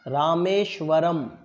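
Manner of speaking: रामेश्वरम stretched out very slowly, syllable by syllable; slow model pronunciation. Shown as a demonstration. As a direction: pronounce रामेश्वरम very slowly so students can follow. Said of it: "मैं बोलूँगा, फिर आपको बोलने के लिए कहूँगा". (रामेश्वरम का बहुत धीमा उच्चारण)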